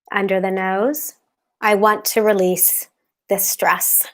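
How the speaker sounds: calm, steady affirmation voice; guided tapping. Acted. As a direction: recite each tapping point soothingly. Leads tapping script aloud.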